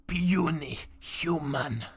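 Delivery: slow, drawn-out sneering creature voice; booming arrogant alien overlord. Acted. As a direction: sneer it down at them, very slowly. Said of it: "And he goes".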